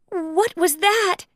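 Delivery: high, slow scared whisper-like ask; startled by the thumping; frightened question. Acted. Minnie startled by thumps upstairs. Minnie gasped, noises above.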